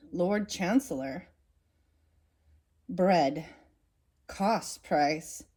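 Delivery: ordinary delivery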